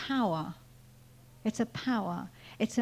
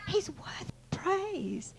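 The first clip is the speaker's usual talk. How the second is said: soft, slow, raised-pitch awe; hushed reverence; 7 semitones higher, 6 dB quieter, slower than usual. Shown as a demonstration. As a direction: drop to a drawn-out hush. Proclamation repeated, first hushed.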